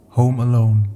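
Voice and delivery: ordinary delivery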